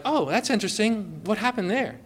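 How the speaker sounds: quietly caring about her scar; gentle concern. Retold or imitated. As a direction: ask softly, with care.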